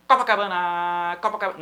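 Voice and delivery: "Copacabana" rushed, then the last syllable dragged out; esempio sbagliato, irregolare. Shown as a demonstration. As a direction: cram "Copacabana" together and hold the final "na" too long. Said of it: "tentar encaixar todas as sílabas".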